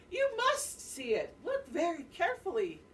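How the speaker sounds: high, loud, pushy Ananasi; insistent spider. Acted. Spider insists, deer refuses.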